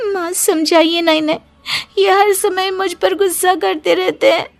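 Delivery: crying, pleading loudly; tearful complaint. Acted. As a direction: plead through tears. Wife complains crying to mother-in-law.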